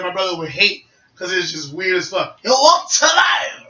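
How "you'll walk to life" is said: high, slow villain cry; drawn-out high battle cry; Frieza. Acted. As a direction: call out a special attack. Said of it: "voice acting of Frieza".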